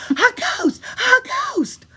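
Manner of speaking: very high, loud scared shriek; terrified shriek. Acted. Tommy shrieking in fright at the ghost, high and loud. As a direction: scream it in panic. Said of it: "He shrieked to high heaven".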